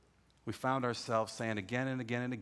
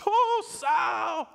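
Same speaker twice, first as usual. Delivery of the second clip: much higher, louder excited shout; Kenny all excited; 6 dB louder, much slower than usual. Retold or imitated. Cousin Kenny telling Sal God did it, excited shout. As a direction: burst out with joyful news. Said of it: "he's all excited".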